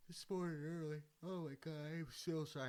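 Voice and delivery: talking through a yawn. Real, not acted. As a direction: yawn while saying it. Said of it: "I hate yawning on these things".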